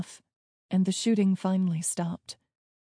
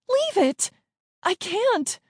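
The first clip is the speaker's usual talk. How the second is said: high-pitched, frantic cries; urgent yelling; 13 semitones higher, 4 dB louder, slower than usual. A narrator's reading. Siblings arguing over the ball under gunfire, frantic yelling. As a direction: yell back in desperation.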